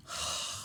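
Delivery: soft defeated sigh; a long tired exhale; weary sigh. A narrator's reading. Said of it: "sank into a chair".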